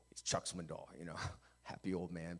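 normal talking voice

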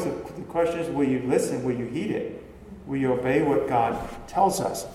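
ordinary delivery

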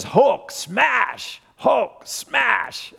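put-on high excited little-boy Hulk smash; child's Hulk smash. Grandpa imitating his grandson shouting Hulk smash, high and gleeful. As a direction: play a toddler roaring Hulk smash. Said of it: "And he says".

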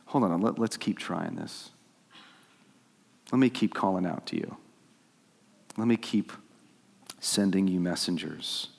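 soft, unhurried pleading with pauses; patient appeal. Acted. Acts patient God after outburst.

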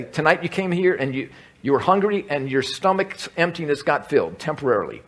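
normal talking voice

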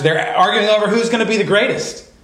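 normal talking voice

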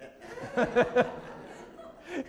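breathy high chuckle; real amused laugh; high airy laugh. Real, not acted. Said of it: "makes me laugh".

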